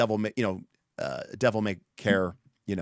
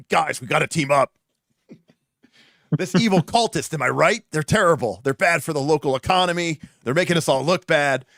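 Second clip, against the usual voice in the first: a hint of a chummy, eager ogre, louder; lightly voiced ogre buddying up, raised; friendly ogre; 4 semitones higher, 5 dB louder than usual. GM voicing a friendly ogre recruiting the party, eager and loud. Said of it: "the ogre was, like, friendly and came up to the party and is like".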